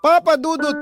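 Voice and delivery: normal talking voice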